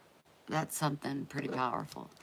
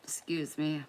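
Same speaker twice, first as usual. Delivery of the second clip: ordinary talk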